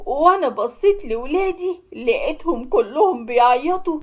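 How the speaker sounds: usual speaking voice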